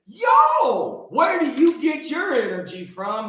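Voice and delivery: high-pitched mock holler; drawn-out high "Yo", then eager asking; imitated excited questioner. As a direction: imitate people calling out a question. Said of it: "People are always asking me".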